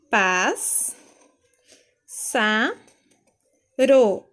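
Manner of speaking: "Pás, sa, ro" one at a time, long pauses; separated syllables. Shown as a demonstration. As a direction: sound out each piece firmly: "Pás, sa, ro". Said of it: "Pássaro vai ficar assim".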